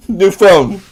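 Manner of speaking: loud, sassy new phone who dis; sassy loud brush-off; mock dismissive. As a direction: brush them off like a stranger. Acting out dismissive texter.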